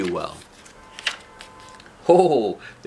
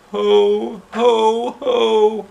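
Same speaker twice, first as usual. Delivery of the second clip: much higher and louder, forced; mournful ho-ho; 10 semitones higher, 6 dB louder, much slower than usual. Acted. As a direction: try to laugh and fail. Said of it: "she added mournfully".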